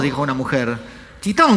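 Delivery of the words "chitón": high, loud one-word "Chitón" command; mock-shout "Chitón" to silence everyone; retold shout. Man silencing the cinema crowd, high shouted "Chitón". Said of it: "gritó otro tipo".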